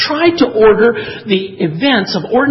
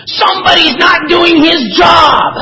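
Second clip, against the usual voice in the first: high, full-voiced outraged shout; Lucy; 11 semitones higher, 7 dB louder than usual. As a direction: shout it in capital letters. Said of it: "she shouts in capital letters".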